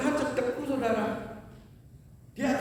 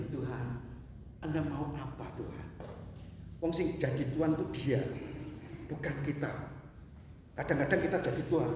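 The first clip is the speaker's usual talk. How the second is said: hushed preaching; 8 semitones lower, 11 dB quieter than usual. Acted. Hushed passage about being silent.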